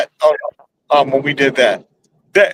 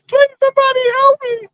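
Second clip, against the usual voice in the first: shrill high falsetto cries for help; fluttering cries for help; faster than usual. Retold or imitated. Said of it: "shrill adaptation of Beckman".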